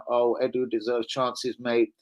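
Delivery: lightly voiced gullible fan; mocking fan quote. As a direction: sneer as you imitate the fans. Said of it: "our fan base get overhyped saying".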